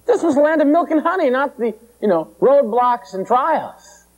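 high, whining panicked complaint, slowing on the end; mock panic. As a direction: wail it like a crowd cheated of its promise. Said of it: "they were ranting and raving".

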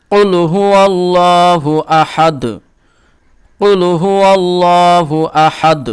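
slow, chanted full verse; teacher recites for students to repeat; tajweed recitation. Shown as a demonstration. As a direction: recite slowly for students to follow. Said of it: "আমার সাথে সাথে আপনারাও পড়তে থাকেন".

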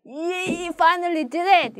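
high, elated demo cheer; triumphant. Shown as a demonstration. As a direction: celebrate finally pulling it off. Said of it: "이런 거". (드디어 해낸 듯 신나게)

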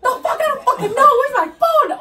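loud, very high mock freak-out; imitated yelling. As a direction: act out a friend losing it. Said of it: "this bitch fucking starts yelling at me".

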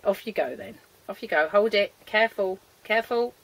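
ordinary talk

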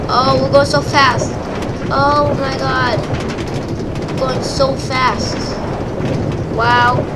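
thrilled and loud on the ride; excited whoosh of speed; riding a roller coaster. Real, not acted.

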